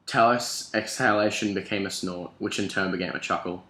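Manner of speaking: usual speaking voice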